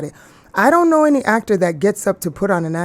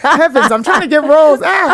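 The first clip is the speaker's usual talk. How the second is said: high, loud laughing plea; excited; 8 semitones higher, 6 dB louder than usual. Real, not acted. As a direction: exclaim it, half laughing. Joking plea for roles.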